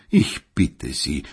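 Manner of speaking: ordinary delivery, nothing marked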